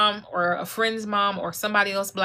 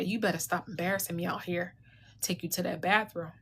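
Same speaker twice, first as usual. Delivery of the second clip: a softer, hushed threat from mom; hushed mom warning; 7 dB quieter than usual. Retold or imitated. Mom quietly threatening her embarrassing child in public.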